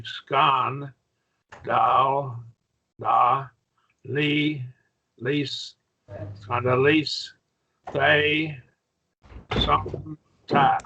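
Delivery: "scon, dal, da, li, lis, they, son, ta" broken into separate syllables, a pause after each. Shown as a demonstration. Teacher showing a student how to divide the word, syllable by syllable. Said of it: "and so you separate your two".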